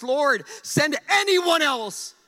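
loud, high, drawn-out plea; pleading. Acted. Moses begs God to pick another, loud desperate plea. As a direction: cry out slowly, begging to be spared. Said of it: "Moses pleads".

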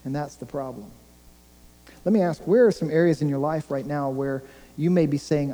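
ordinary talk

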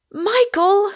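high, loud, anguished cry of his name; woman's frantic appeal; distressed cry. A narrator's reading. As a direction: cry out in dismay, pitch way up. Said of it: "cried Rosamond, wringing her hands".

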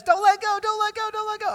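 very high, repeated pleading squeal; mock panicked child. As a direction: imitate a terrified kid clinging on in the pool.

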